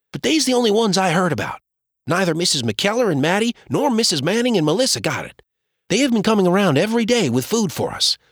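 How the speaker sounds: put-on a worried child rattling off news; boy's voice.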